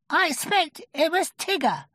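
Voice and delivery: very high, timid little voice; small creature piping up nervously. A narrator's reading. Piglet naming a suspect, nervous and high. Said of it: "said Piglet nervously".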